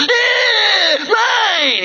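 high-pitched toddler shriek, imitated; upset little kid. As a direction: squeal it like a possessive toddler. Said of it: "they get all upset".

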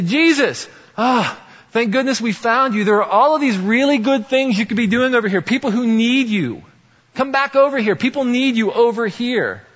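high, loud, pleading call; anxious disciples calling Jesus back, high and loud; urgent plea. Retold or imitated. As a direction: beg him to come back, urgently.

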